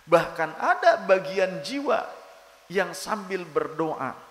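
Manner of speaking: high, loud, fast fervent preaching; preaching at full force. Acted. Preacher urging listeners on silent zikir, preaching at full force. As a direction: drive the point home with fire.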